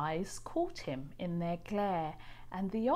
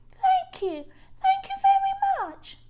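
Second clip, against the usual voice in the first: much higher, louder delighted thanks; bubbling playfully; 8 dB louder than usual. A narrator's reading. Little blue fish thanks rainbow fish, high and delighted. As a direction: gush with joy. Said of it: "bubbled playfully".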